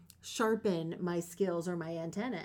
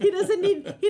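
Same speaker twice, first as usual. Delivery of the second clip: high, loud laughing delivery; 11 semitones higher, 9 dB louder than usual. Real, not acted. Host teasing the guest about packing, laughing.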